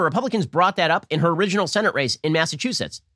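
normal talking voice

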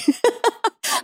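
a high, sudden laugh; high burst of laughter. Real, not acted. Laughs at own screaming.

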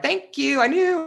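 high, gleeful gloat; smug I-told-you-so. Pretend. Friend gloating about the future move, high and smug. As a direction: crow that you were right all along.

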